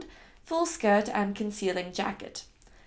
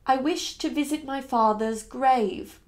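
slow, evenly spaced words; patient, word by word; 2 semitones higher than usual. Acted. Young lady explaining to her slow driver, one patient word at a time. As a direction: spell it out patiently for him. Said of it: "one patient word at a time".

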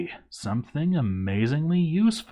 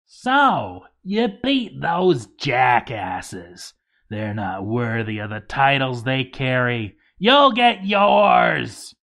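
goblin leader's shout; 4 dB louder, slower than usual. Acted. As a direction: draw it out and bellow a threat down the mountain. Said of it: "there was a shout from the top".

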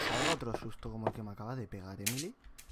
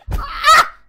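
high piercing shriek of fright; startled scream; 21 dB louder than usual. Real, not acted. Player shrieking at a jump scare, high piercing scream. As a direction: shriek as it jumps out.

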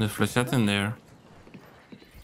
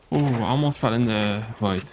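ordinary talk, nothing marked

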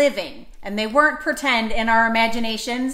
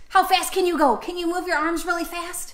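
fast dinosaur; 7 semitones higher than usual. Acted. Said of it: "Dinosaur fast".

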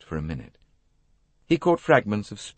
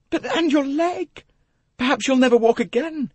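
very high, louder wail; distraught young woman; 6 dB louder than usual. Acted. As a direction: lament in dread, pitched way up. Jackie acted, wailing over his leg.

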